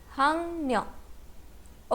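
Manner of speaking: "हांग न्योक" with ng, then N. Shown as a demonstration. Teacher repeats answer for learners, "हांग न्योक" with ng and N. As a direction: sound out "हांग न्योक", hak ryok turned to hang nyok. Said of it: "क्या pronounce होगा?".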